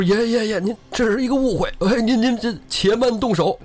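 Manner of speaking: high-pitched acted begging; cowed robber talking fast-witted excuses up high; pleading. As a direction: stammer out a plea to hold off. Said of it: "赶紧求饶".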